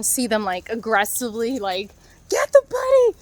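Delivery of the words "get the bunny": high, loud mock yell; imitating yelling. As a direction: squawk it like the angry birds. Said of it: "they're, like, yelling at our dog".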